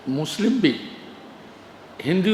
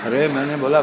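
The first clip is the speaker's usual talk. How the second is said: ordinary talk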